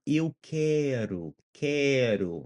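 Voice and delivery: "quero" drawn out slowly with a tapped R, falling. Shown as a demonstration. Teacher models I want, slow tapped-R "quero". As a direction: stretch "quero" and flick the R. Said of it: "That ru, ru, ru".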